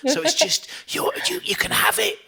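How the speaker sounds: hammed-up high, hushed aside; conspiratorial. Server slipping ham to a vegan, mock conspiratorial. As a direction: drop into a mock secretive whisper.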